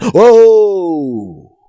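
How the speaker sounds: loud, high amazed gasp; mock amazed crowd. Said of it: "the same crowd that was going".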